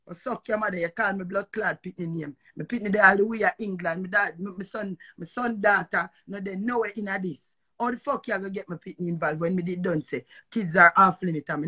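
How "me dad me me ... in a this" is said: usual speaking voice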